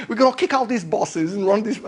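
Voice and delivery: badly faked British working-class accent; terrible British worker accent. Workers threatening the bosses, bad British accent. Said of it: "sorry, terrible accent".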